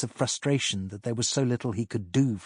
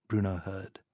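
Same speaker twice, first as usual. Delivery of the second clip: ordinary delivery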